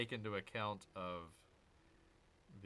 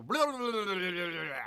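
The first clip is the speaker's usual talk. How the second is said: high, loud rapid blah blah; a hammed-up fast-talking Flash; mock Flash speed-talk; 6 semitones higher, 8 dB louder than usual. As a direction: rattle it off like a speedster. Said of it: "Do you talk faster when you do Flash?".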